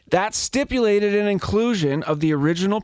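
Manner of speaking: usual speaking voice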